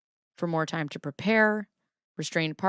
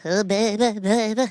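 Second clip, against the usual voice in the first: playful pop-star sung impression; hammed-up singer's croon; 3 semitones higher, 4 dB louder than usual. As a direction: do a short mock pop-diva hook. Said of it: "it's like when [name] thought she was British".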